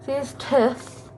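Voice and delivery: high, slow, mocking "See this tooth?"; mock wrong tooth. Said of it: "say to this tooth".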